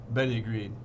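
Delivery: ordinary delivery, nothing marked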